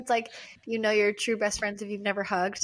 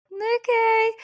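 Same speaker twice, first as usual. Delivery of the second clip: very high, louder squeaky reply; bashful mock acceptance; 11 semitones higher, 5 dB louder than usual. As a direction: accept it in a tiny high put-on voice. Acts own bashful reply to affection.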